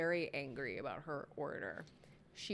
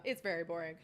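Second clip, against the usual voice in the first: normal talking voice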